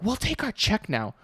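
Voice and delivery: high, softened mock-customer voice; imitated demanding customer. Waiter imitating a table demanding the check, high mock voice. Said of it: "they're, like, yelling at me across the restaurant".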